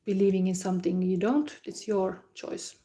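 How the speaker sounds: normal talking voice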